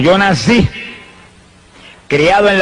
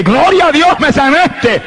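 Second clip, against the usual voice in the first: joyful cry; 10 semitones higher, much slower than usual. Retold or imitated. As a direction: burst out in praise. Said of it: "Yo dije". (grito de júbilo)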